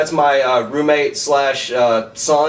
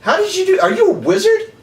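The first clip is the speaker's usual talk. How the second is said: high, incredulous questions; real wonder, pitched way up; amazed disbelief. Real, not acted. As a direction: friend asks if he is a wizard, astonished. Stunned by the card trick.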